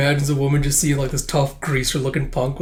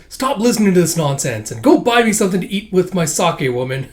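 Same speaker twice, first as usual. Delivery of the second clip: raised, higher acted scolding; gruff scolding husband; 4 semitones higher, 4 dB louder than usual. Husband ordering his wife off to buy food, mock scolding. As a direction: order her off in mock irritation.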